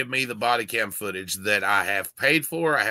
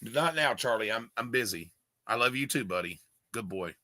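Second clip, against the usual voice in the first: quiet, gentle words to a pet; soft to the dog; 7 dB quieter than usual. Real, not acted. Quietly soothing his dog Charlie while on camera. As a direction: settle the dog down softly.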